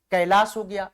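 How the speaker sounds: ordinary talk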